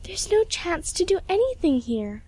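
put-on high-pitched earnest girl; arguing. A narrator's reading. Pupil arguing with her mentor, high and pleading. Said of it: "argued Emily".

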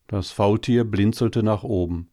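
ordinary delivery, nothing marked

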